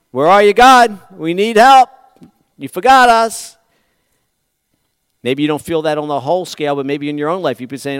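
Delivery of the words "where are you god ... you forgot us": louder, higher plea of abandonment; crying out to God. Retold or imitated. Preacher voices people crying out that God forgot them, loud and pleading. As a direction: call up to heaven like someone left behind.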